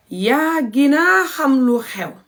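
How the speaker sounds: slow demo. Shown as a demonstration. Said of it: "This is the proper Gambian Wolof".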